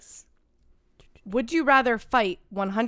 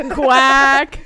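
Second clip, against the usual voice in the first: loud quack through laughter; laughing duck imitation; 2 semitones higher, 10 dB louder than usual. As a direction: quack it loudly, cracking up.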